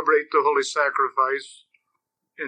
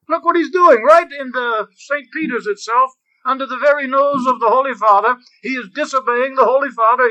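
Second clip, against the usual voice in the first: much higher and louder, outraged pointing; indignant outburst; 9 semitones higher, 8 dB louder than usual. Retold or imitated. Preacher voicing the priest's outrage to cardinals, much higher and louder. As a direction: jab the cardinals and cry out, high and loud. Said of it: "punches these two cardinals next to him and says".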